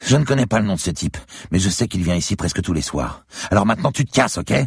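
high-pitched, quick female voice; irritated barmaid. A narrator's reading. Woman to the gunman who wants a name, irritated. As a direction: hand over the information and dismiss him. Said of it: "Elle gloussa".

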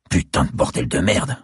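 growled curse. A narrator's reading. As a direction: spit the curse out in disgust. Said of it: "grogna-t-il".

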